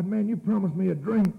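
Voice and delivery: high-pitched, aggrieved complaint; acted protest. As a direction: protest like you've been cheated. Acts out complaint about broken promise.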